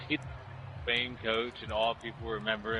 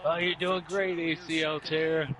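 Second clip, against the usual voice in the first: mock encouragement; 6 semitones higher, 5 dB louder, slower than usual. Mock encouragement in acted exchange.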